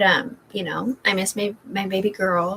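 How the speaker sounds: ordinary talk, nothing marked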